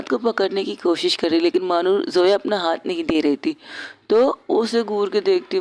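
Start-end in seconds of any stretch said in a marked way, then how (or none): none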